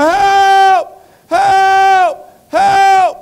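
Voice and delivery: high, very loud drawn-out cries; screaming for help. Acted. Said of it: "the man was down there going".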